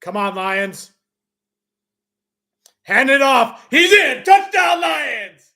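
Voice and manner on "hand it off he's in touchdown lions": high, loud shout, easing on the last word; excited touchdown call. Real, not acted. Announcer calls the Lions' touchdown to fans, exultant shout. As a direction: erupt as the runner scores.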